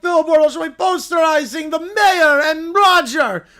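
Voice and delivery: high, loud villain crowing, voice cracking at the end; gloating villain shout. Acted. Villain shouting his triumph over the mayor. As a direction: crow it over the whole town. Doof gloating in cartoon recap.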